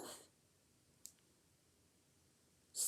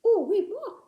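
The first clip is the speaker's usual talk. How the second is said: high excited puppet; 7 semitones higher, 6 dB louder than usual. Acted.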